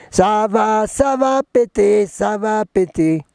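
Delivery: high, drawn-out comic wailing; mock doom wail. Joking about the grave state of the planet, mock doom wail. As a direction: stretch it slowly and high like a mock alarm.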